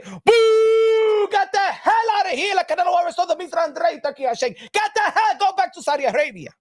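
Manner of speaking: boo him off the stage like an angry crowd; mock booing crowd. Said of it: "should have been".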